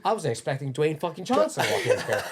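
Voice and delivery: high, loud, laughing mock protest; flustered outburst. Gym owner excuses broken cable, flustered outburst. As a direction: blurt it out in hammed-up exasperation.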